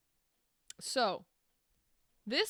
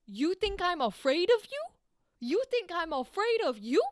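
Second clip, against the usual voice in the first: high-pitched, incredulous squaring-up; defiant challenge; 7 semitones higher, 3 dB louder than usual. Acted. Brutus refuses to fear Cassius, defiant challenge. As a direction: throw the question back as a dare. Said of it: "That is him going".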